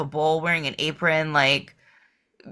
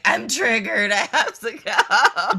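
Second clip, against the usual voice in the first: laughing; 6 semitones higher, slower than usual. Retold or imitated. Retelling own reaction, laughing.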